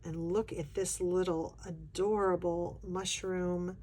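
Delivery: ordinary talk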